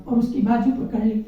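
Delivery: ordinary talk, nothing marked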